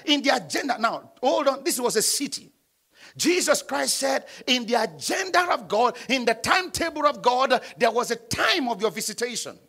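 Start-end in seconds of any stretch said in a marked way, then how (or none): none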